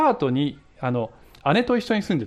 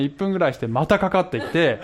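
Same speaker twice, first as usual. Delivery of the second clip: ordinary talk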